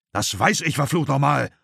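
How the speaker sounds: father explodes at his wife; shouted curse, pitch climbing; roaring. A narrator's reading. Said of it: "brüllte Dad".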